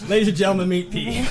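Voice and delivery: high, loud stage introduction; mock show announcer. Comic introducing Pete at the end of the show, mock announcer. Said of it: "I'd be like".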